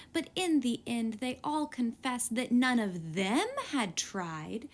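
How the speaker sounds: plain reading voice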